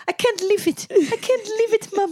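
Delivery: high mock-child voice with a Greek accent; little Greek accent. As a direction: imitate an upset boy with a Greek accent pleading to mum. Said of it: "in his little Greek accent".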